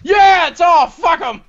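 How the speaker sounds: high, loud victory whoop; gleeful shouted gloating; triumphant yell. Real, not acted. Celebrating the console turning off.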